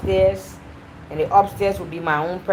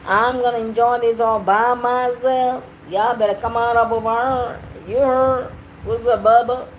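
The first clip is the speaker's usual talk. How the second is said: put-on over-the-top Southern accent, pitched up; fake Southern accent; 4 semitones higher than usual. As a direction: lay on a deliberately fake Southern twang. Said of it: "most ugliest southern accent you ever heard?".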